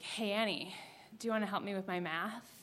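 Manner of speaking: soft, low, sneaky murmur across the aisle; sly hushed ask. Retold or imitated. Caleb poking her in class, softly sneaky request. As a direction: keep it quiet so the teacher won't hear.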